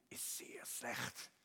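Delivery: slow, whispered bad news; retold diagnosis in a drawn-out whisper; hushed verdict. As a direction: drop to a grave whisper, word by word. Retelling a grave hearing test result.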